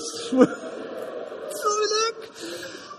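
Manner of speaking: breathless, high squeal of a laugh; giddy laugh. Real, not acted. Welsh fan thrilled about the quarterfinals, squealing laugh. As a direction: laugh it out, caught off guard with delight. Overcome laughing at football news.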